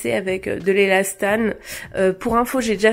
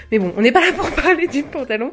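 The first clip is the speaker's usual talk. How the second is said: quick, higher, laughing through the words; 5 semitones higher, faster than usual. Real, not acted. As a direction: laugh at yourself for going off topic. Catches herself rambling about trousers. (en riant, plus vite et plus aigu)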